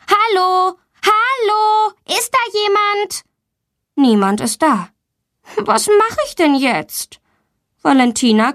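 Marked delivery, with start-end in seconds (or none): 0.1-3.2 put-on high child's call for help; calling out loud. A narrator's reading. Locked-in girl calls for anyone outside, high calling voice. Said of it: "Sie ruft laut". 5.6-7.2 high, slow helpless question; worried. A narrator's reading. Stuck girl wonders aloud what to do, high and slow.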